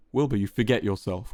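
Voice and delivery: ordinary delivery, nothing marked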